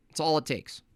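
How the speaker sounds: ordinary delivery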